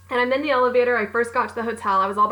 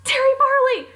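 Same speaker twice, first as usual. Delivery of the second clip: high, loud call across the room; yelled name; 5 dB louder than usual. Retold or imitated. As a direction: shout her name to stop her. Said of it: "I yelled out".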